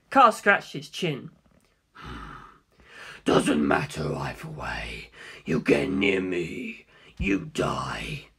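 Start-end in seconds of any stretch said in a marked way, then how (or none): 3.2-8.3 very low, slow whispered threat; Kaz's menace. Acted. Kaz warning Funny not to come near, low whisper. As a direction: warn him off in a deadly hush. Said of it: "Kaz threat, low whisper".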